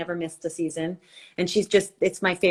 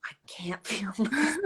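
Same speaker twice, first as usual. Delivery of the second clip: mock-pained whisper; slower than usual. Comic complaint about waking up aching.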